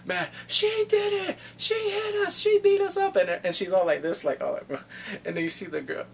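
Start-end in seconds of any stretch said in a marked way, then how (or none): 0.1-3.2 tattle loudly like beaten-up thugs; whining gangsters. Retold or imitated. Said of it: "the Gangpes are going".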